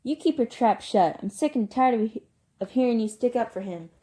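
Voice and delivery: loud, high, fed-up rebuke; angry turning on someone. Acted. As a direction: shut him down furiously. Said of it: "Derry turned on him".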